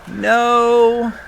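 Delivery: mock exasperated drive-thru correction. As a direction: jump up high on no, then firmly point out the right one. Worker reads wrong order twice.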